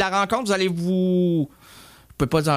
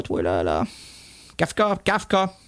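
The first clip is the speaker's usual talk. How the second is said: normal talking voice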